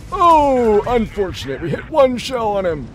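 put-on high, loud old man groan; a sighing old gamer voice. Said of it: "I'll still play like Old Man Blitz".

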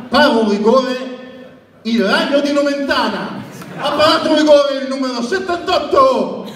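stadium announcer hailing a saved penalty; high shouted name calls; breathless italian goal commentary. Shown as a demonstration. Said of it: "Quattro ne hai parlato".

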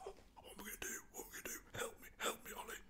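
high, whispered panicky whine; mock panicked dog. Dog begging Ollie for help as dogs charge. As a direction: whimper for help like a frightened dog. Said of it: "and he's like".

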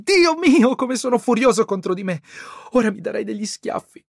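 weep and rage at yourself; tearful brother. A narrator's reading. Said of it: "si mise a piangere".

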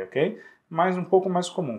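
ordinary delivery

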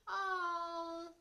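high, long-held "ohh"; disappointed groan. A narrator's reading. Pupils hearing swimming is cancelled, groaning. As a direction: groan like a let-down class. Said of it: "groaned the class".

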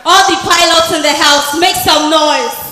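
big hype call; crowd hype shout. Real, not acted. MC hyping crowd.

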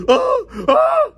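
short high nightmare yelps; imitated scream. Retelling a cellmate's nightmare, high mock screams. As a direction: mimic someone screaming in his sleep. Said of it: "I didn't wake up screaming".

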